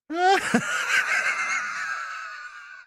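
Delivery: high, helpless burst of laughter; real laughing fit. Real, not acted. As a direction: burst out laughing and let it trail on. Cracks up at the mishap.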